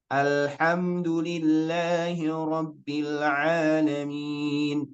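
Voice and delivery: slow, drawn-out chanted recitation; Quran recitation. Shown as a demonstration. Teacher recites Al-Fatihah to show the opening al, slow chanted recitation. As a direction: recite with long, steady held vowels. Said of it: "Seperti surat Al-Fatihah".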